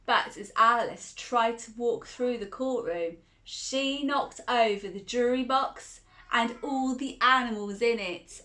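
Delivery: plain reading voice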